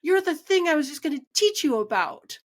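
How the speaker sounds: husband amazed his wife has no mind's eye, excited high voice. Retold or imitated. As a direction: play the husband thrilled by the discovery. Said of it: "And he goes".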